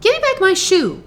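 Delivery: put-on angry girl's demand, high and loud. A narrator's reading. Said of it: "grew angry and said to the witch".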